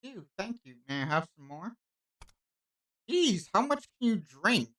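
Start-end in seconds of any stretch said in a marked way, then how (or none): 3.1-4.7 exasperated. Acted. Annoyed host at thirsty guest.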